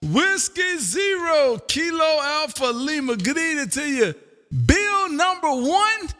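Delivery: beam out his call letters; excited greeting. Real, not acted. Greeting a friend who answers.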